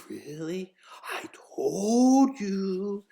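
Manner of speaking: whispered start into drawn-out rise; gloating mom. Acted. Mother to her son who admits she was right, gloating. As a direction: stretch it out slowly like an I-told-you-so.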